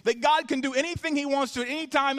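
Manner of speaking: pastor urging the congregation to trust God, rising preaching cadence. Acted. Congregation hears a building sermon, fervent preaching.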